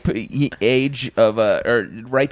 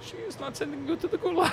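mock Russian accent; 10 dB quieter, faster than usual. Russian agent phone call.

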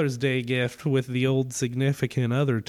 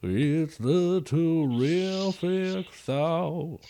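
put-on slow, drawn-out Creole accent jingle; Creole accent theme song; 2 semitones lower, slower than usual. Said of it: "do the theme song in a Creole accent".

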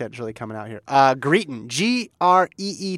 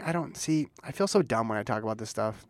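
usual speaking voice

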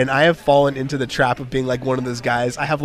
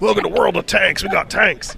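higher-pitched mock American yokel; 5 semitones higher, 4 dB louder than usual. Playful hick voice for America joke.

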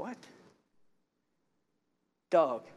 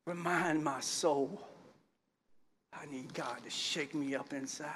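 ordinary talk, nothing marked

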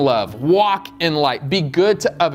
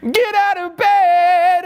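high, slow, louder mock mother calling; mom waking me; 14 semitones higher, 5 dB louder, much slower than usual. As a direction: call him out of bed like his mom, high and drawn out. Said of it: "that's literally how I started every day".

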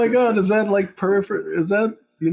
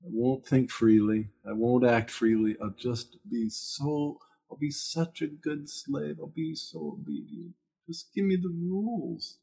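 mock submissive pleading; 4 dB quieter than usual. Begging for rules as a good slave, meekly pleading. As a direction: plead meekly, trailing into a very soft promise. Voicing a fearful, submissive people-pleaser.

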